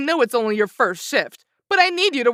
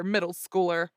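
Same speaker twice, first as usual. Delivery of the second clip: ordinary delivery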